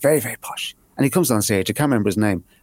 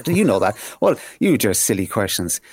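usual speaking voice

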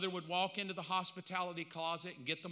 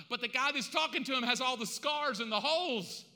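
loud, driving sermon delivery, pitch raised; full-force preaching; 7 semitones higher, 7 dB louder than usual. Acted. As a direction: build the scene in urgent, pounding phrases.

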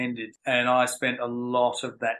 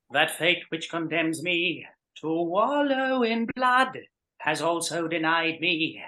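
slow, high, theatrical Phantom delivery with soaring final word; Anthony Warlow impression; 5 semitones higher, slower than usual. Impersonating Anthony Warlow for the podcast host, slow and grand. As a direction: ham up the operatic villain, pitch leaping on flesh. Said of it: "He would go".

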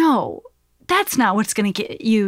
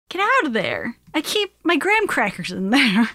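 ordinary delivery

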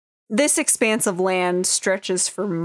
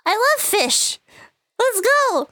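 put-on very high, eager child's voice; excited little girl; 13 semitones higher than usual. Little Sarah agreeing to go fish with grandpa, very high eager child voice. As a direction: chirp it like a thrilled small girl. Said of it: "And she's like".

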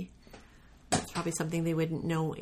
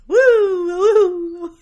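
high loud wolf howl; 14 semitones higher, 17 dB louder than usual. Pretend. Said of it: "You howled like a wolf".

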